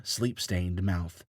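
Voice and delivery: plain reading voice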